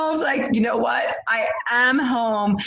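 elated, a little in awe; joyful realization. Retold or imitated. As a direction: say it like you finally found home. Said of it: "And I was like".

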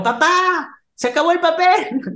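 very high-pitched imitation of a kid; child calling out. Tiago calls his dad from the toilet, mock child's holler. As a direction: mimic a child calling for dad from another room.

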